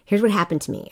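ordinary talk, nothing marked

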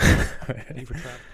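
flight attendant voice. Acted. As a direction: chirp it like cabin crew over the PA. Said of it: "you sound just like a flight attendant".

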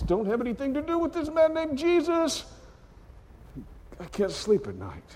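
high urgent plea; Pilate's wife. Retold or imitated. Wife urges Pilate in a high worried voice. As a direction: warn him off, high and anxious. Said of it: "whispers in his ear".

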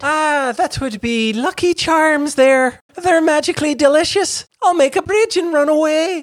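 high, put-on leprechaun sing-song. Co-host does a high leprechaun voice naming Irish food. Cereal mascot leprechaun bit.

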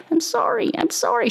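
put-on small, high-pitched kid's apology; apologetic child. Retold daughter apologising.

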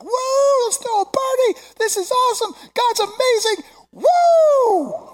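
high, loud whooping cheer; wild celebration. Acted. Preacher imagining the people's ecstatic reaction, wildly excited. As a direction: whoop it up like a crowd at a party.

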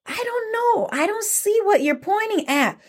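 very high, sing-song cluelessness; playing innocent. Bush Deer fools mad Anansi, high innocent act. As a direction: feign total ignorance in a high little voice.

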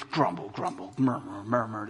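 low, muttered grumble-murmur imitation; mock grumbling. Shown as a demonstration. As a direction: sound it out like a grumbling crowd. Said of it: "What is this?".

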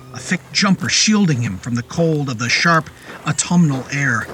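usual speaking voice

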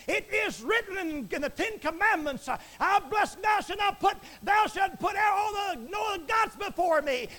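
high, loud, full-force preaching; shouting preacher. Acted. Preacher condemning idol gods to his listeners, shouting. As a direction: thunder the commandment at the congregation.

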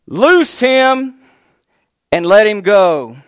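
high ringing shout of loose him; loud command. Acted. Jesus orders the crowd to unbind him, shouted. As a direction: release him with a cry.

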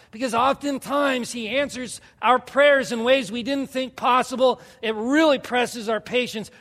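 high, forceful sermon cry; impassioned preaching. Real, not acted. Pastor urging the church to stay with God, impassioned preaching. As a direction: drive each phrase home to the congregation.

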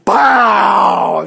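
loud mouth-made bang; gunshot noise. Retold or imitated. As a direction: bark out the rifle shot. Imitating the rifle shot.

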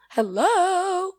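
a high, loud, gleeful Hello!; excited exclamation. Real, not acted. Vlogger thrilled about dubbing cartoons, excited cheer. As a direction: cheer it out like great news.

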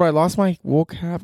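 ordinary talk, nothing marked

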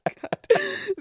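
high, loud put-on jeer; slow, hammed-up high insult; mock insult.

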